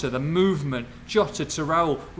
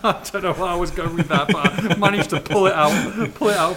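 laughing while talking; 4 semitones higher, 6 dB louder than usual. Real, not acted. Laughing at his own segue.

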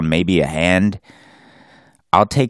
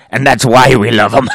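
gleeful cheer; 7 semitones higher, 8 dB louder than usual. Retold or imitated.

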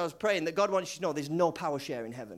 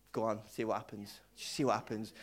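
hushed, low coaxing inner voice; a soft inner voice whispering go on; quiet inner urging; 9 semitones lower, 9 dB quieter than usual. Retold or imitated. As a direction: nudge yourself softly to let go. Inner voice urging him to give.

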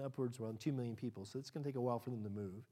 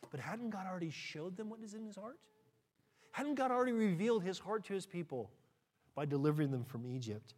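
ordinary talk

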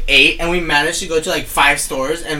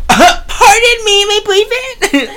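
mock posh greeting; 10 dB louder, much slower than usual. High mock-formal greeting, laughing.

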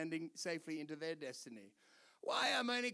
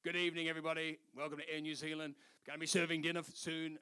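blokey man's voice; 5 semitones lower, faster than usual. Retold or imitated. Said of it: "a real man's voice".

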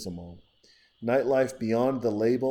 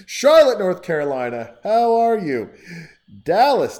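much higher and much louder, calling out; excited shout-out; 7 semitones higher, 13 dB louder than usual. Real, not acted. Host greeting listeners in their cities, loud and excited. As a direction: shout out to the cities like a hype man.

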